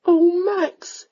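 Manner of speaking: high, drawn-out plaintive cry; tearful plea. A narrator's reading. Young lady tearfully calls to the lord, plaintively. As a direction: wail his name high, moved to tears. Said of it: "she said once more".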